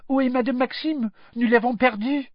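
high, hurried French schoolgirl; quick. A narrator's reading. Lost French girl asks after her headmistress, quick. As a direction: rattle off the French question urgently. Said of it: "turned and said quickly".

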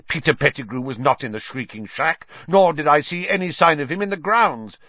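indignant snarl, rising in pitch and force. A narrator's reading. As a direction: argue it back angrily. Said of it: "snarled Snape".

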